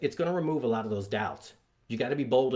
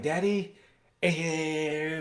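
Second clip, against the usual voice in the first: lightly voiced kid, pitched up; reluctant child; 5 semitones higher, much slower than usual. Son balking at a second jump, lightly mimicked high protest. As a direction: whine a little like an unwilling son.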